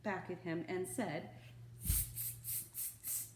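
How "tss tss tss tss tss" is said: soft unvoiced tss-tss chatter; mocking monkey tsk. Monkeys mocking the peddler, soft unvoiced tsk-tsk. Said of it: "the monkeys ... said".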